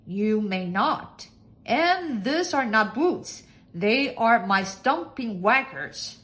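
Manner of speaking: slow, loud lordly correction; loud, deliberate master's voice; pompous master. A narrator's reading. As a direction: correct her slowly and grandly.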